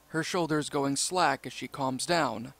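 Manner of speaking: ordinary delivery, nothing marked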